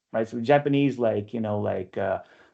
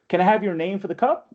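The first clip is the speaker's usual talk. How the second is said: brighter, higher perky customer-service voice; lightly sing-song barista cheer; 6 semitones higher than usual. Acted. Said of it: "perky barista".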